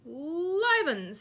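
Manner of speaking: loud high boom. A narrator's reading. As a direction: boom it jovially. Sir Patrick booming.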